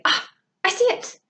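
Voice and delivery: high, breathy gasp of realization; Noah. Acted. Teacher imagines a pupil spotting the pattern, excited gasp. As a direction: act out a pupil's sudden discovery. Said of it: "I can hear Noah saying".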